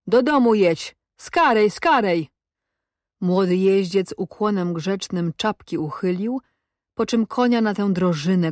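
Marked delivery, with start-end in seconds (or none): 0.1-2.3 higher urgent calling-out, falling on each word; urgent officer's cry. A narrator's reading. Officer hurries a young rider home, urgent cry. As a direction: call out a pressing order. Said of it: "dwa razy powtórzonym wykrzyku oficera".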